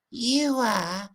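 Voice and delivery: high squeaky puppet-duck voice; high, tiny mock duck voice. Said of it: "two songs".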